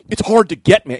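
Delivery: loud, high, snotty retort; imitated rude clerk. Store clerk brushes off a customer, rudely imitated. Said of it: "He's actually being a dick".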